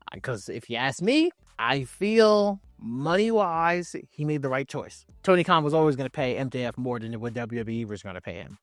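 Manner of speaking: normal talking voice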